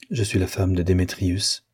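plain reading voice

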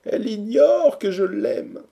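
high, slow, loud complaint; lovelorn lament. A narrator's reading. Lovesick hero laments to friends. (plainte amoureuse, lente et aiguë)

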